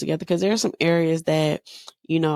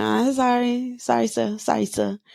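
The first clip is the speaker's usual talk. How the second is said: sheepish apology; 8 semitones higher than usual. Retold or imitated. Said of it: "And I be like".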